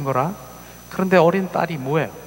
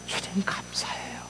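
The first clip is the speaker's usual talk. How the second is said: breathy hushed 주님 감사해요 with weight on 주님; 주님 pressed softly in a whisper; whispered thanks; 5 semitones higher, 10 dB quieter than usual. Acted. Heart's whispered thanks to Lord.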